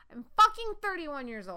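loud, exasperated outburst; outraged at the insult; indignant. Real, not acted. Indignant at being called child.

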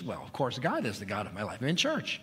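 mock pious shrug of an answer; lightly mocking the stock answer. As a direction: toss it off like a churchgoer on autopilot.

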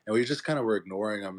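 normal talking voice

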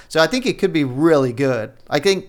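ordinary talk, nothing marked

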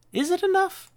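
very high, squeaky disbelief; comically baffled, high-pitched query; incredulous question. Real, not acted. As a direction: question it with a high upward swoop.

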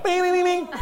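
high "bing" bell sounds; bell imitation. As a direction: ring it out like a little bell.

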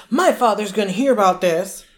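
imitating a bratty movie threat; loud open threat. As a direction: announce it loudly for all to hear. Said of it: "it's not like how he is in the, in the movies".

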